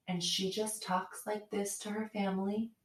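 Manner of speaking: soft, even, controlled voice; calm, quiet tone. Retold or imitated. Mom who mastered not yelling, calm quiet tone. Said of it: "very calm, quiet tone".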